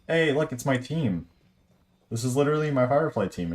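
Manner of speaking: normal talking voice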